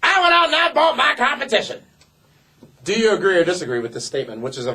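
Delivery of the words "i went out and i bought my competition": mock Vince boast.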